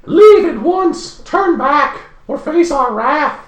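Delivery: very high, loud and slow threat; high elven warning. Acted. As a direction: warn the intruders as a lofty elf. Elf warns the party off.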